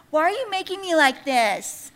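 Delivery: high, loud, whiny mock protest; mouthing-off little pot. Little pot mouthing off to its maker, high mock complaint. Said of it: "this little pot starts mouthing off to me".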